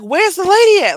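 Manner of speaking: loud, high-pitched outburst imitating a panicked parent; freaking out. Roommate demanding to know where the lady is, mock freak-out. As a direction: shout it high, mimicking his freak-out. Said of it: "he started freaking out".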